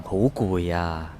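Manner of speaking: weary slow sigh, low and soft; tired. Acted. Boyfriend brushing off his girlfriend, slow and weary. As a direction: drag it out like you're worn out. Boyfriend pleading exhaustion in quarrel.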